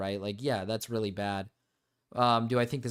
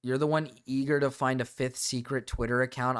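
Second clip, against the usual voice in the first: ordinary talk, nothing marked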